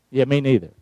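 ordinary talk, nothing marked

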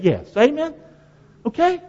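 ordinary delivery

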